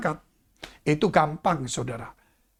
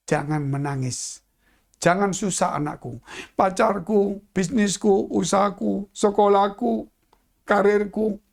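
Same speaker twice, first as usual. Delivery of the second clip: tender comfort; 3 semitones lower than usual. Acted. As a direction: soothe them gently, almost hushed. Jesus comforting the listener.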